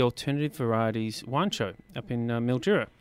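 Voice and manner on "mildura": ordinary delivery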